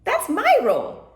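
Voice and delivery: high, loud crowing rooster. A narrator's reading. Portuguese rooster bursting in, high proud crow. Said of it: "started to sing".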